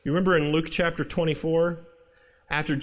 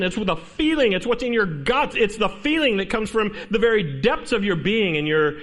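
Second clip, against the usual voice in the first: normal talking voice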